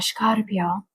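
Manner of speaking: Scorpio with a Castilian Spanish sh, SH-kar-pio. Shown as a demonstration. Tarot reader saying the sign her way, Scorpio in Castilian style. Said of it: "I like to say it like, um, you know, uh, Castilian".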